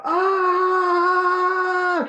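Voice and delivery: high loud pained cry; mock cry of pain. Retelling the injured worker's cry, loud high wail. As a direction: wail as if hurt.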